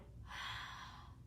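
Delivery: long breathy open-mouth exhale; slow audible exhale; H sound breath. Shown as a demonstration. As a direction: fog the mirror with an audible haah. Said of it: "use kind of like an H sound".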